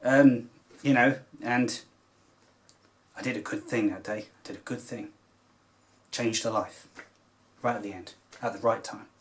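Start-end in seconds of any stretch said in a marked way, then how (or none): 3.2-9.1 choked up. Real, not acted. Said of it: "Oh, I'm getting all emotional".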